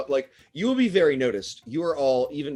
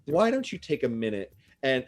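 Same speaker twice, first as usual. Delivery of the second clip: ordinary talk, nothing marked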